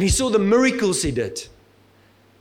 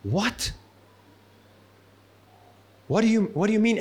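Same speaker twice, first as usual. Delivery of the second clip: baffled; 2 semitones higher than usual. Retold or imitated. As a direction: mutter it, lost and confused. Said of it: "his face probably was going".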